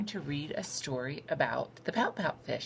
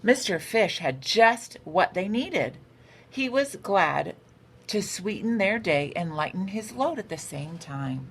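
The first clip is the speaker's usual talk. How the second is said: bright, lively storybook read-aloud; a teacher reading a picture book to her class; reading to children; 3 semitones higher, 6 dB louder than usual. A narrator's reading. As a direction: read it like story time for little kids.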